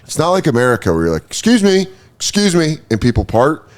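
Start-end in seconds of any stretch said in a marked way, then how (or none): 1.3-2.8 higher-pitched, easy 'excuse me'; polite crowd request. Retold or imitated. Festival-goer asking people to part, polite and higher-pitched. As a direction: call out politely to get past.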